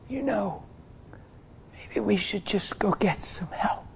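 hushed, resigned murmur; barely voicing the thought; quiet. Husband murmuring to wife about getting help, hushed.

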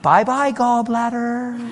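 high singsong goodbye, drawn out at the end; mock farewell. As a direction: brush it off with a flippant send-off.

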